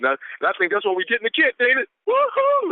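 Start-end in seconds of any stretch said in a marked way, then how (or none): 2.1-2.7 high, delighted "woohoo"; soaring celebratory holler; jubilant whoop. Real, not acted. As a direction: cheer it out high with real glee. Celebrating the team's kit.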